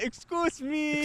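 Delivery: high, soft haughty mimicry; mock arrogant. Listener repeating the line she liked, mock haughtiness. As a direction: imitate a snooty man, pitched well up. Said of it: "I like the".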